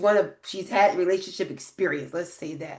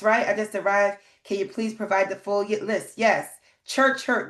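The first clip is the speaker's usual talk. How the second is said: ordinary delivery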